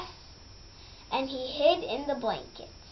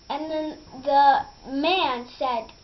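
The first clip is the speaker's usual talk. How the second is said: usual speaking voice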